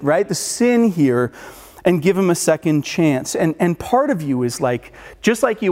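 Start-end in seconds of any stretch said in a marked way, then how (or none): none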